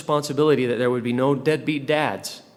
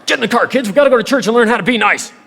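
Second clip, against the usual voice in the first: much higher and louder, fast hammed-up yell at the kids; mock frantic parent; 10 semitones higher, 9 dB louder, faster than usual. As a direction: play the frazzled mom rushing everyone out. Harried parent hurrying kids to church.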